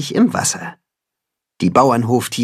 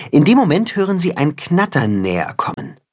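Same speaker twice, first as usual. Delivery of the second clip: ordinary delivery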